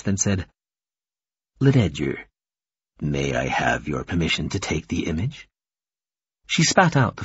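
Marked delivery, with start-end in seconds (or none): 1.6-5.5 hushed, deep formal voice; formal visitor. Acted. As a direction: ask permission gently and formally in a lower voice. Formal male visitor asking consent.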